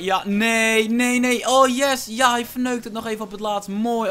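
high, loud cries turning to a cheer; real excited outburst; panicked then relieved. Real, not acted. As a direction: shout in alarm, then celebrate the opponent's blunder.

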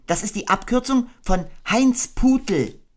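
put-on high boy voice; a thoughtful boy; Christopher Robin voice. Christopher Robin guessing who Piglet is, musing.

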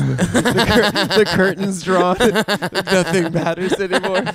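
loud, slow words through laughter; helpless laughing delivery; laughing tease. Real, not acted. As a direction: crack up while painting the gloom. Laughing through teasing friend's gloom.

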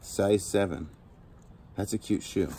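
ordinary talk, nothing marked

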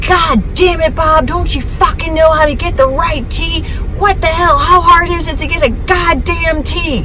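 high-pitched imitated tirade; exasperated mom. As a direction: mimic Mom berating Dad in exasperation. Retold overheard tirade of Mom.

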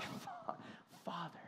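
breathy near-whispered Father; barely voiced, tender call to God; soft intimate whisper. Believer whispering to God, a tender hushed call.

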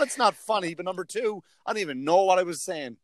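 ordinary talk, nothing marked

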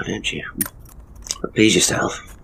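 ordinary delivery

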